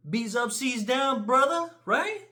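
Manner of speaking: high, slow chant of the slogan; a put-on high gang-banger voice; mock gang slogan. Imitating a blood, slow and high.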